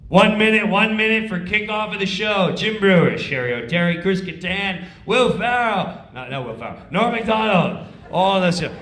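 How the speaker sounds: loud, firm backstage call; stage manager calling cast to places. Retold or imitated.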